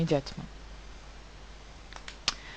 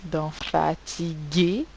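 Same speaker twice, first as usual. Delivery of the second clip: slow fatigué; slower than usual. Shown as a demonstration. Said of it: "j'entends à la fin le son et".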